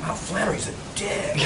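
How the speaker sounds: a viewer quietly judging him; muttered judgment. Retold or imitated. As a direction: mutter it under your breath. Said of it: "you'd be like".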